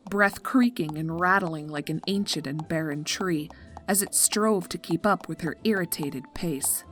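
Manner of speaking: ordinary delivery, nothing marked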